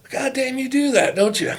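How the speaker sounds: high, loud disbelief at his son; incredulous outburst. Retold or imitated. Coach to son, amazed he never noticed, high and loud. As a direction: pitch it way up in disbelief. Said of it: "I said".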